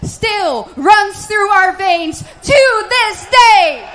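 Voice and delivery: rousing speech to a crowd; rally speech. Acted. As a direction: shout it out to a rally.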